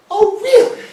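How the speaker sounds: high mocking "Oh, really?"; snide comeback to the clerk; sarcastic retort. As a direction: throw it back at her, mock surprise.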